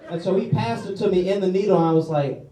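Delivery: ordinary talk, nothing marked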